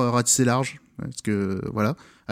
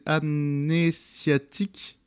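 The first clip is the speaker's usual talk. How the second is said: syllable by syllable; much slower than usual. Shown as a demonstration. Announcing an album title to listeners, syllable by syllable. Titre d'album détaché puis redit.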